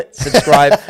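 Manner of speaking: loud, high, giggly plea; laughing. Real, not acted. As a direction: burst into laughter on the word. Giggling through the sign-off.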